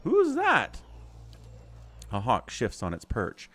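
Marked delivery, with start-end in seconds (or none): 0.0-0.8 high, puzzled; surprised question. Real, not acted. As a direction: ask it in surprise. Puzzled at the exiled queen.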